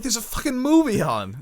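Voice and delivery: high and loud, fed up; hiss-free exasperated snap at a stranger; high indignant complaint; annoyed retort. Retold or imitated. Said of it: "I'm like".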